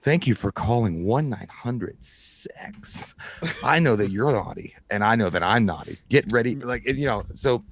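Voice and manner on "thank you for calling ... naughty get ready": slow, low seductive phone-sex greeting; much lower, slow mock sultry voice; sultry hotline recording. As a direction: purr it like a sexy recorded message. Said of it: "and then they were like".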